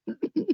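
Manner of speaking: short squeaky giggle; high giggle. Real, not acted. Giggling after a good roll.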